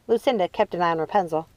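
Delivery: ordinary delivery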